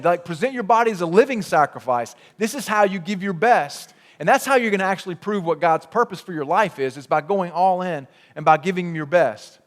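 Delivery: ordinary delivery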